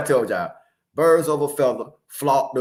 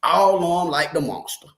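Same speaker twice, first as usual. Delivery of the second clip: ordinary talk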